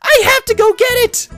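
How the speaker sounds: very high, loud frantic squeal; urgent cartoon shriek; Pinkie Pie. Pinkie rushing off for supplies, frantic.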